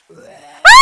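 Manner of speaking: sudden real scream of fright, very loud; startled scream. Real, not acted. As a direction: jolt out a loud scared scream. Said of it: "Oh god, that scared me".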